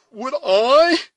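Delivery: high, loud, outraged question; indignant echo. A narrator's reading. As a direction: recoil in disbelief. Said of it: "echoed the indignant and astonished youth".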